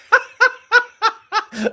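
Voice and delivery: high, loud burst of laughter; loud giggling fit; helpless laughter. Real, not acted. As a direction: crack up in real amusement. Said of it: "I'm just laughing".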